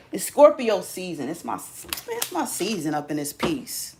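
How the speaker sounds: ordinary delivery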